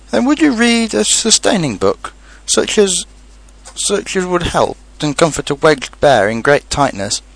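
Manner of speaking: high, earnest plea; tearful, pleading bear. Acted. Pooh, wedged, asking to be read to, tearful. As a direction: ask pitifully for comfort.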